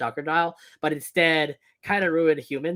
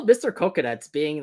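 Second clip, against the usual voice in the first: ordinary talk, nothing marked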